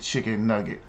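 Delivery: put-on low, soft, deadpan kid voice; a hint of a small child, quiet and flat; little boy's answer. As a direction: say it like a toddler answering innocently. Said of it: "He said".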